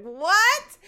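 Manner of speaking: high, rising incredulous "what"; retold shocked reaction. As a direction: blurt it out in disbelief, pitch shooting up. Shocked reply to friend's news.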